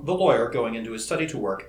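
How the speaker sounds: ordinary delivery, nothing marked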